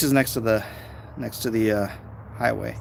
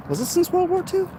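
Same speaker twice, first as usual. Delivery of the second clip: puzzled. Real, not acted. Unsure self-question.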